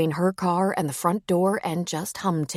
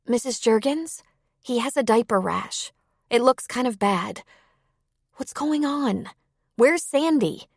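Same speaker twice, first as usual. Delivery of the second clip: put-on higher-pitched, questioning young caller; concerned Sierra; 7 semitones higher than usual. A narrator's reading. Sierra asks about a baby with diaper rash, concerned. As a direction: raise the pitch and press for answers.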